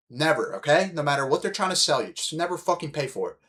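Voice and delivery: ordinary delivery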